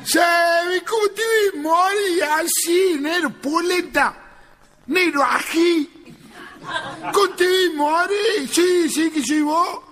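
comic fake crying at a wake; mock wailing. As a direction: wail over the coffin like a drunk. Drunk wailing over the coffin.

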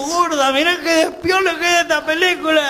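a lightly acted excited husband; voicing himself to his wife. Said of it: "Y le digo".

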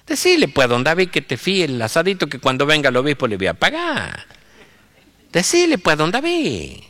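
high-pitched lilting rural plea; wheedling village priest; insistent country priest. Acted. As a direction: leap up high on the first word, then fall away. Priest repeats instruction to sacristan.

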